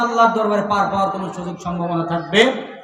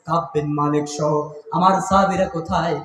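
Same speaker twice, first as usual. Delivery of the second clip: ordinary delivery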